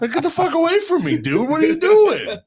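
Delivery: high, loud laughing yell; panicked yell. Retold or imitated. Telling friends how he yelled at Josh, high panicked laughing yell. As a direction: shout him away in mock panic.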